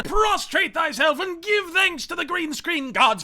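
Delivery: high, slow, grand decree; mock-biblical proclamation. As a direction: proclaim it slowly like a prophet to the faithful. Mock biblical command to worship.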